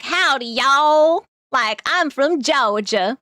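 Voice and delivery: put-on slow, drawn-out Southern US accent. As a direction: ham up a Georgia accent, slow. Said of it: "I don't know how to do the accent".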